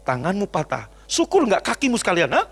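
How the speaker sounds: mock father's "serves you right"; acted gloating scolding. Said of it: "Apa kemudian Bapak bilang".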